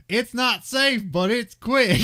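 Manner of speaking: high, loud hammed-up coworker; mock coworker voice. Reckless coworker defending the crane trick, high and loud. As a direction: belt it out like a reckless foreman. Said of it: "And he's like".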